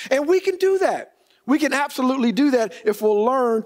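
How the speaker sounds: sermon build to its peak; preaching. Acted. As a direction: preach it up, lifted. Preaching, pitch raised.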